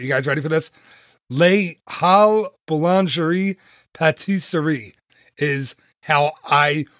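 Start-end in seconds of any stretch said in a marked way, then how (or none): 1.3-4.9 clumsy attempt at a French accent, word by word; butchered French. Shown as a demonstration. Podcast host trying a French bakery's name for listeners, butchered French accent. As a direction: have an earnest, careful go at French and butcher it. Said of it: "I'm gonna butcher it".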